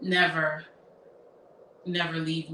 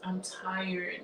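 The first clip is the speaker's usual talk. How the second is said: much softer, weary murmur; hushed complaint; 10 dB quieter than usual. Retold or imitated. Mother murmuring to daughter during service, hushed.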